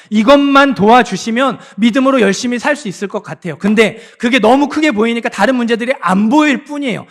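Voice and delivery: loud, high, driving sermon delivery; full-force preaching. Acted. Pastor pressing the congregation, loud impassioned preaching. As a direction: push it home to the congregation.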